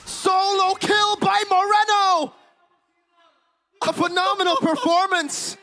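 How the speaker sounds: excited. Real, not acted. Caster hypes the kill.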